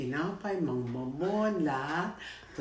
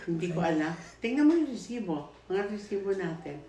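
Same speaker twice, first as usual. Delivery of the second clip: excited retold chatter; 7 semitones higher, 7 dB louder than usual. Acted dialogue among salesladies.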